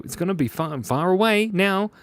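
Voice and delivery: ordinary talk, nothing marked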